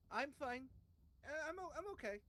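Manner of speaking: high, loud put-on character voice; hammed-up impersonation of an injured companion; Clarence. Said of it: "my wonderful impersonation skills".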